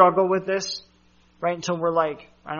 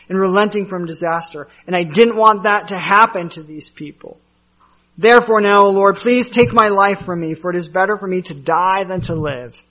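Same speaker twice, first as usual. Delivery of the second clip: loud, heated plea to God, voice raised; angry prayer; 3 semitones higher, 9 dB louder than usual. A narrator's reading. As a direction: pour out the anger at God, pleading loudly. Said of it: "And he was angry".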